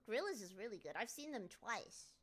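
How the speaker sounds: ordinary talk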